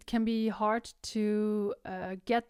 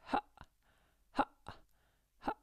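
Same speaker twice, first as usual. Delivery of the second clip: three gentle ha, each started by a clean closing of the cords; teacher shows the sound of vocal cords closing, soft separate ha; soft glottal onsets; 6 dB quieter, faster than usual. Shown as a demonstration. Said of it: "but just the sound".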